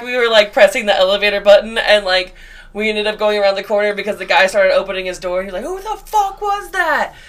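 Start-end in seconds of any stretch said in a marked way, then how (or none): 5.6-7.1 high, drawn-out mock outrage; imitated outraged resident. Resident opening his door after the bang, indignant cry. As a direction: act startled and indignant, pitch way up.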